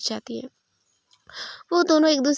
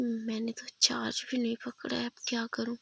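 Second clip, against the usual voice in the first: whisper; 7 dB quieter than usual. Acted. Lisa worried over dead phone.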